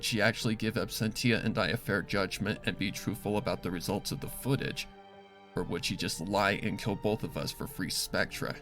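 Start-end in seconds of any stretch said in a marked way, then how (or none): none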